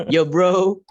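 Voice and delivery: cool rapper-style "yo bro"; Malik-style greeting. Retold or imitated. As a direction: toss it over like a hip MC. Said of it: "I pass to Mister Malik".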